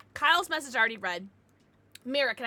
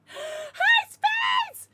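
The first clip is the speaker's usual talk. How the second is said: gasp, then a much higher, louder hi; thrilled shouted greeting; excited greeting; 9 dB louder than usual. Real, not acted. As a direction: squeal a delighted hello.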